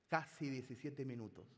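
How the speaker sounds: normal talking voice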